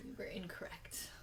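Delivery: rueful near-whisper; hushed admission. Real, not acted. Co-host owning up about last week's low point, hushed. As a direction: say it very quietly, almost without voice.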